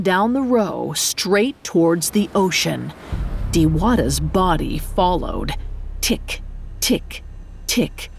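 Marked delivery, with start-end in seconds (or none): 6.0-8.1 ticking whisper. A narrator's reading. Monster's tongue ticking sound.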